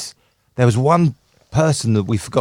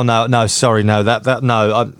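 usual speaking voice

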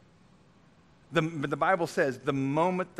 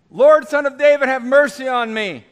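full-voice desperate cry, much higher and louder; 12 semitones higher, 17 dB louder than usual. Retold or imitated. Blind beggar shouting to Jesus on the road, desperate cry. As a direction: cry out at the top of your voice for mercy. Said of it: "cries out at the top of his voice".

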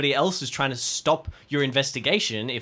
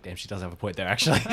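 inner voice; 7 semitones lower, faster than usual. Retold or imitated. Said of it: "Alistair on the inside is like".

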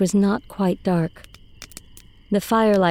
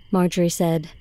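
plain reading voice

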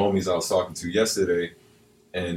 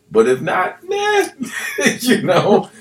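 laughing at his own joke; cracking up mid-sentence, high laugh; corny dad laugh; 8 dB louder than usual. Retold or imitated. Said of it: "doing my dad thing, laughing at my own jokes".

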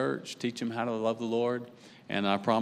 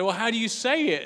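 much higher, retold baffled retort; exasperated question; 12 semitones higher than usual. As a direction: ask it high-pitched, mock exasperated. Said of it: "I said".

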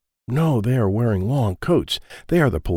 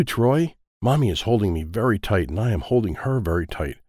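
plain reading voice

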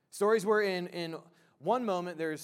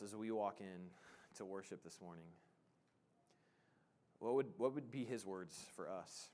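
low, soft prayer with long pauses; hushed, unhurried pastoral prayer; public prayer; 5 semitones lower, 5 dB quieter than usual. Real, not acted. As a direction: pray aloud quietly, pausing between phrases. Leads the congregation in prayer.

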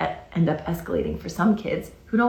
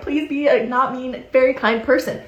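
mock pleading; 6 semitones higher than usual. Parent mimicking herself begging her child to be kind, mock pleading. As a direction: plead with them in a hammed-up way. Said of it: "'Cause we're like".

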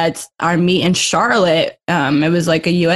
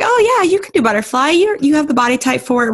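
upbeat coach voice; 8 semitones higher, faster than usual. Retold or imitated. Coach Chris voiced urging butterfly.

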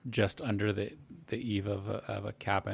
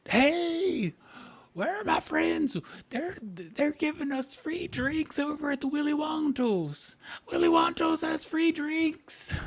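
very high-pitched excited holler; shouting passer-by. Acted. Townsperson calling friends to free drinks, high shouting. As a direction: scream it out along the street. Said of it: "You hear another individual shouting as they're walking along".

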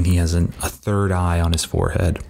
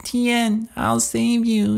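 put-on much higher, slow, childlike squeak; baby voice; much slower than usual. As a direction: squeak it out like a tiny doll-child hero. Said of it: "He's got, like, a baby voice".

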